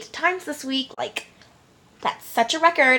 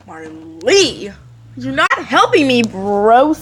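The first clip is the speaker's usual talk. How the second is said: exasperated whine; 4 dB louder, slower than usual. Retold or imitated. Pleading to friend, odd timbre.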